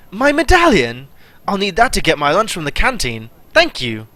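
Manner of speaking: delighted Artificer. Acted.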